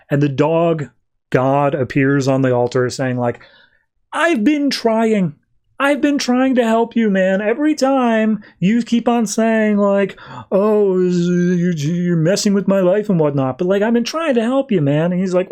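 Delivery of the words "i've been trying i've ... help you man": high, drawn-out frustrated whine; exasperated complaint. Retold or imitated. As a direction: act out a helper at wit's end. God complains his help is unappreciated.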